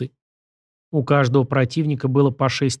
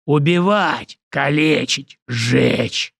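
battle roar; much slower than usual. Acted. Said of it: "взревел противник".

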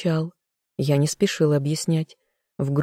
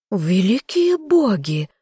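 high, slow horrified exclamation; drawn-out, theatrical shock; aghast gasp; 5 semitones higher, slower than usual. A narrator's reading. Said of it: "ахнула герцогиня".

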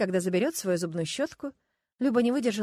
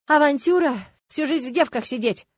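loud, higher-pitched indignant retort; angry; 5 semitones higher, 7 dB louder than usual. A narrator's reading. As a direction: throw the word back at a timid friend crossly. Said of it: "сердито сказала подруга". (сердито, громко, с возмущением)

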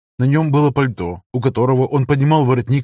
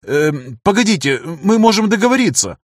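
высоко и испуганно, сбивчиво; торопливо оправдываясь, высоким голосом; испуганное бормотание; 7 semitones higher than usual. Acted. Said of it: "начал бормотать мужчина". (испуганно, высоким голосом)

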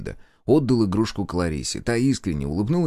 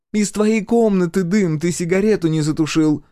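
seething retort; 10 semitones higher, 6 dB louder than usual. Acted. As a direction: throw the blame back at father, high and forceful. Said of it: "процедила". (громкий раздражённый упрёк на высоких нотах)

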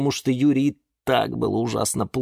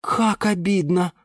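slow, high commiseration; sympathetic dismay; 11 semitones higher than usual. A narrator's reading. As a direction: lament it for a friend. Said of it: "охнула она". (протяжно, с сочувствием)

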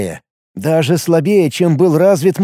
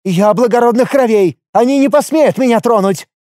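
hysterical noble; 7 semitones higher than usual. A narrator's reading. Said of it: "истеричный Таталем Со".

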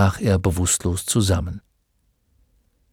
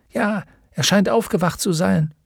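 higher voice from outside; lightly higher villager voice; 8 semitones higher than usual. A narrator's reading. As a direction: report it casually. Someone outside the tent.

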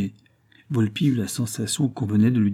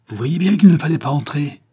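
raised, high, loud rebuke; angry roar; 6 semitones higher, 7 dB louder than usual. A narrator's reading. Sonia rebukes police who burst in, roaring. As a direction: roar it at the intruders. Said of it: "rugit Sonia".

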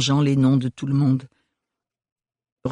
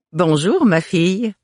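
higher, loud jovial hello; jovial greeting; 7 semitones higher, 4 dB louder than usual. A narrator's reading. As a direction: greet heartily, pitched up. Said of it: "s'exclama jovialement une dame".

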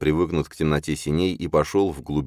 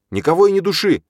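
loud, high-pitched outburst; angry retort; 7 dB louder, faster than usual. A narrator's reading. Heated family quarrel over the baby, angry retort. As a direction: throw it back at full voice.

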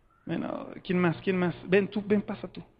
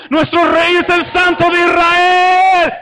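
long, slow, high war shout; grito de guerra; 7 semitones higher, 7 dB louder, slower than usual. Shown as a demonstration. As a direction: shout it out, stretching the end. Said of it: "Vamos, grítenlo". (grito de guerra alargado y fuerte)

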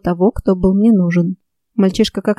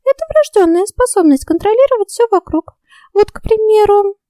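high, proud little fairy; boastful fairy voice; 13 semitones higher than usual. A narrator's reading. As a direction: show off like a vain fairy.

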